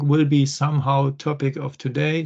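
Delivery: ordinary delivery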